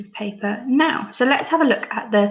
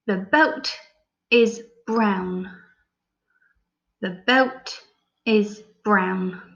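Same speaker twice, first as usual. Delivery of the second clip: slow, with pauses between phrases; dictation; much slower than usual. Shown as a demonstration. Teacher dictating sentence to pupils. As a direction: dictate it clearly for writing. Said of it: "sentence I want you to write is".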